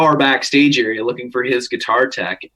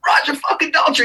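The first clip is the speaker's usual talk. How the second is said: mock scream; faster than usual. Fan grabbing rock star for photo, mock screaming. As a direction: imitate a hysterical fan's scream without full volume. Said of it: "And I just screamed".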